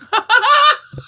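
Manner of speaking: squealing laugh way up high; shrieking giggle; high burst of laughter. Real, not acted. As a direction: break into a high delighted laugh. Laughing at own story.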